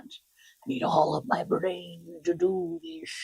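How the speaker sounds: slow hushed whisper; playful whisper. Acted. To her viewers about needing full focus, a slow whisper. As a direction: breathe it out slowly, like a secret.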